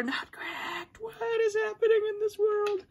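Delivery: very high, squeaky mock dismay. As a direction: squeak it out in disbelief. Mock outrage at wrong parts.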